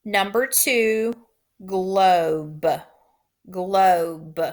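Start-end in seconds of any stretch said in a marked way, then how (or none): 1.7-4.4 "globe" said carefully with a long o; dictation word. Shown as a demonstration. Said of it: "Tap the sounds in globe".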